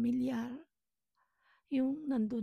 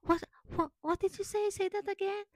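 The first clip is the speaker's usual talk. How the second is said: high, louder imitation of a baffled caller; questioning caller; 9 semitones higher, 4 dB louder than usual. Callers ask her to repeat herself, imitated baffled questioning. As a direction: mimic a caller who can't follow, voice pitched up. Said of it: "pag tinanong ka nila".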